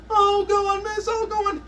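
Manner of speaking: very high pleading whine; whiny kid pleading. Retold or imitated. Whiny pupil pleads with miss, very high whine. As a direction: beg like a little kid. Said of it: "The whiny ones".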